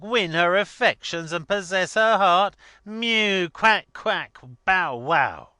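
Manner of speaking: loud, high, derisive ranting; as if gone mad with scorn; mocking outburst. A narrator's reading. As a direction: jeer at full volume with animal noises. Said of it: "stared at him in his outburst".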